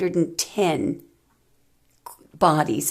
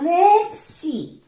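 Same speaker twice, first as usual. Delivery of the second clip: "Let's see" jumps much higher, sung out; sing-song tease; 13 semitones higher, 3 dB louder than usual. Shown as a demonstration. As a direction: tease the reveal up high. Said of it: "teasing build-up to the reading".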